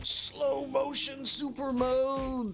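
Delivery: high, long stretched-out yell; playful slow-motion holler; hammed-up battle cry. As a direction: stretch every word into a high attack cry. Playing out a slow-motion attack.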